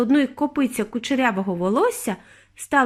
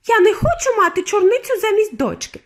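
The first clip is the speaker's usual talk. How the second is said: very high, loud protest; outraged scream; 11 semitones higher, 5 dB louder than usual. A narrator's reading. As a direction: scream it like an indignant mother. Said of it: "закричала пані Борегард".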